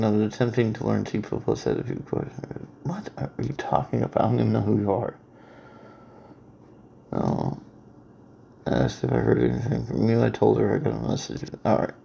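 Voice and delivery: soft, absent-minded reading under the breath; reading to oneself. Real, not acted. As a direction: read it quietly, only for yourself. Reads incoming messages to himself.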